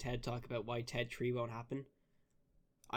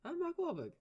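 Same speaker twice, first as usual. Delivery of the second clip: very high squeaky mock Boston voice; mock Boston actor squeak; squeaky Mark Wahlberg; 4 dB quieter than usual. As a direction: squeak it out, fading away at the end. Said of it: "That's just the Boston accent".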